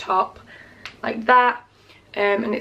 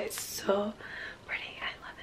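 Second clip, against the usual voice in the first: hushed unvoiced whisper over the gifts; 17 dB quieter than usual. Vlogger whispering to her viewers about the gifts. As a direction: drop to a secret whisper.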